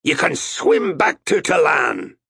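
loud, high taunting shout. A narrator's reading. Captain to the sailor thrown overboard, shouted. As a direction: yell it over the side. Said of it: "he shouted".

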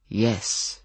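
expressionless; without expression. Acted. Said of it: "Stefan said without expression".

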